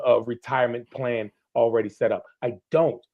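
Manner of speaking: ordinary delivery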